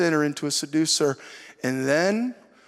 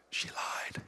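breathy stage whisper of the punchline; whispered aside; 16 dB quieter than usual. Retold or imitated. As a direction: drop to a secret whisper. Wife's fib told as a punchline.

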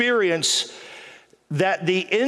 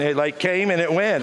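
normal talking voice

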